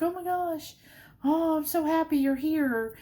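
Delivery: high, gushing dog voice; the dog overjoyed to see her owner; voicing the happy dog. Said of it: "she was like".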